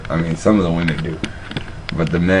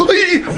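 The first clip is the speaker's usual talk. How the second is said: high squeaky "Beep!" like a checkout scanner; sharp electronic beep. Retold or imitated. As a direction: chirp it out like the machine. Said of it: "They go".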